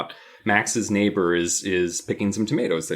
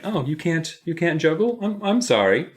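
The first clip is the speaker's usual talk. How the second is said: higher-pitched pretend sympathy; mock apology; 7 semitones higher than usual. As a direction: act out a fake, condescending sorry. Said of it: "I would also be like".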